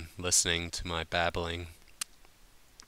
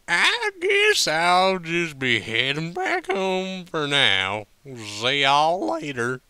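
high, slow, slurred country drawl; slow drunken drawl; 8 semitones higher, 7 dB louder, slower than usual. A narrator's reading. Applejack slurring her goodbye to her friends, drunk and slow. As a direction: draw it out like a tipsy farm pony. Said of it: "Applejack said slowly".

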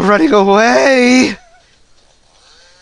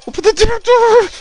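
yelling high at the door; frustrated yell; 12 semitones higher than usual. Real, not acted. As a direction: shout it up high, exasperated. Yelled demand at door.

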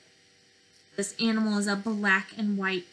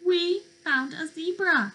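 pleased announcement; 6 semitones higher than usual. Acted. Excited discovery for children.

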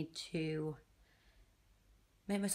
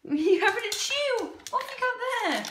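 pet talk; 13 semitones higher, 6 dB louder than usual. Real, not acted. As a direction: greet the dog in a squeaky cooing voice.

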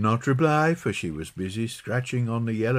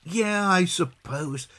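put-on slow, reluctant child's voice, raised in pitch; gloomy; 6 semitones higher, slower than usual. A narrator's reading. John reluctantly accepting Peggy's route, gloomy drawl. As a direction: agree glumly, dragging it out. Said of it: "said John gloomily".

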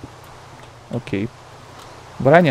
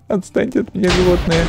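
laughing; 5 semitones higher than usual. Real, not acted. Laughing at attacking mobs.

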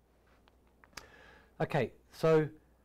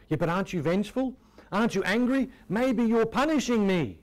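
higher, louder, worried believer pleading with God; anxious doubter; 7 semitones higher, 5 dB louder than usual. Acted. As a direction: ask it like a frightened believer afraid of punishment.

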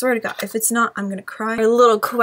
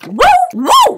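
high, loud whoop; excited cheer; 7 dB louder than usual. Real, not acted. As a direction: cheer out in triumph. Finds clover code.

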